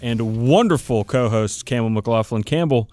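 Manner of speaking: ordinary talk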